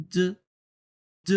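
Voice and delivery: "জ" as an airless dz; tongue pressed for "জ", no puff of breath; no air released. Shown as a demonstration. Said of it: "তখন কোনো বাতাস বের হবে না". (বাতাস ছাড়া "জ")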